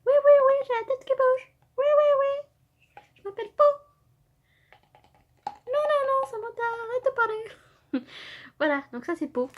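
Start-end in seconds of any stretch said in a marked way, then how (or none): 0.1-3.6 high, loud squeaky toy voice; cartoon toy answering cheerfully, high and loud; bobblehead figurine voice. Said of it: "je joue avec mes figurines". 5.5-8.1 high squeaky toy voice telling Samantha off; mock-stern cartoon toy, high-pitched; figurine scolding. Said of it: "je joue avec mes figurines".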